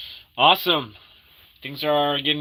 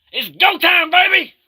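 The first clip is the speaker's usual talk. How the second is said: very high, loud hype shout; hyped shout; 10 dB louder than usual. Acted. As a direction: scream it like a hype man.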